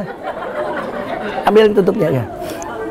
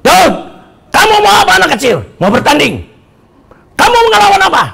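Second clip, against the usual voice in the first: taunting giant; 6 semitones higher, faster than usual. Acted. Goliath confronts little David before the fight, taunting giant. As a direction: bellow mockingly at a small opponent. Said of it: "Lalu dia menghadap pada Goliat".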